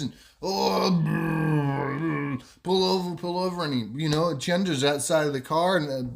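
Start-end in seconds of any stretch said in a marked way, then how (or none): 0.4-3.6 about to vomit. Acted. Wado feels sick in the car, mock retching.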